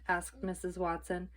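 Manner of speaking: ordinary delivery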